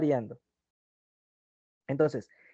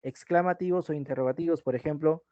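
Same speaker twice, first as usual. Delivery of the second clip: usual speaking voice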